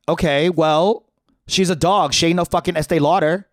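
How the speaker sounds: loud sassy retort; sassy comeback. Retold or imitated. Teen defending his dog, sassy retort. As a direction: fire it back with attitude. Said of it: "I fired back".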